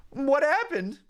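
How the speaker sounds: high, worried sidekick asking; partner Mudkip voice. Acted. Mudkip partner asking the fallen Riolu, high and worried. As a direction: ask it high and anxious like the Mudkip.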